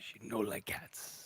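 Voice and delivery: slow, hushed mock Russian accent. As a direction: drag out a bad Russian accent under your breath. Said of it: "one of the worst Russian accents".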